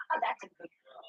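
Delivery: breathy whispered praise. Real, not acted. Praising the little girl in a soft whisper. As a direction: whisper it fondly.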